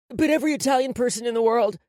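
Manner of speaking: put-on fast, loud, high child's voice; quick. A narrator's reading. Threatened boy hurries to repeat the apology, quick. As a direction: rush the words out anxiously. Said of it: "he said quickly".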